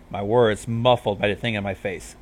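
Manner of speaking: ordinary delivery, nothing marked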